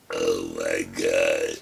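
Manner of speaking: ordinary talk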